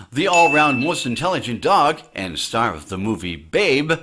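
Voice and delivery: ordinary talk, nothing marked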